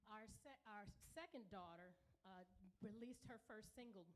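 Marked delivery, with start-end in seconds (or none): none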